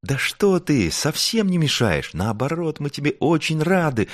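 high, eager reassurance; clamoring welcome. A narrator's reading. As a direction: crowd of friends chiming in happily. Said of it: "загалдели все".

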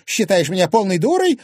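high, loud outraged squeal; indignant shriek. Acted. Wife losing patience with unruffled husband, shrieking. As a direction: shriek it at him, affronted. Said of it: "взвизгнула Анфиса".

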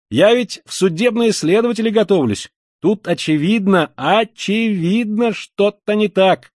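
high, fervent insistence, slowing on the repeat; heated exclamation. A narrator's reading. Would-be investigator convincing Koch something is wrong, heated cry. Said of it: "горячо вскричал молодой человек".

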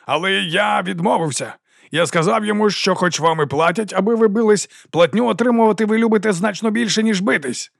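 high, declaiming crowd-pleaser; ringing oration. A narrator's reading. Captain tells troops he refused the prince, ringing oratory. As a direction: play to the cheering soldiers. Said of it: "Його голос дзвенів".

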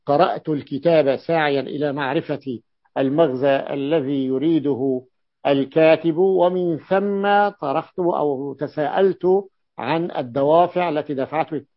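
measured, deliberate reading of a sample sentence; reading aloud. Acted. Teacher reads sample text aloud.

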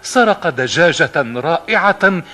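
high, loud shouting ogre's wife. Acted. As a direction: yell at the boy to keep out. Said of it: "فصاحت قائلة".

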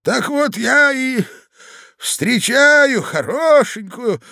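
put-on high, loud, slow old man; drawn-out, high-pitched old storyteller. A narrator's reading. Old husband starting his tale to his wife, slow and high. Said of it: "начал старик".

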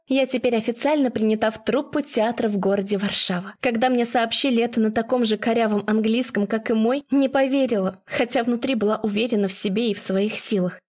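ordinary delivery, nothing marked

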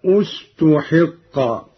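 استحق begun on "u": ustuhiqqa; slow, deliberate ustuhiqqa for استحق; starting with damma. Shown as a demonstration. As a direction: open استحق with a clear u on the hamza. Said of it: "البدء بضم الهمزة. في قراءة قالون هكذا".

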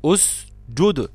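اسجد drawn out very slowly, usjud; اسجد spoken very slowly to isolate the jim; slow stretched demo. Shown as a demonstration. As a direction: stretch اسجد to show the letters. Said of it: "لما أقول مثلا".

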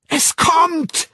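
very high, loud yell; piercing high cry; frenzied scream. A narrator's reading. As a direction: scream it out in ecstasy. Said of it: "schrie Sarojini Engels".

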